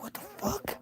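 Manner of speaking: whispered disbelief under the breath; whispered 'what the fuck'; whispered aside. Pretend. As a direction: mutter it so nobody hears.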